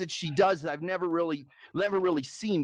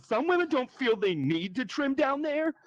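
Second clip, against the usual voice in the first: higher-pitched, affronted woman impression; mock indignant; 5 semitones higher than usual. Woman on a date answering the comic, mock indignant. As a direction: raise the pitch as if mildly offended. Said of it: "she got very indignant".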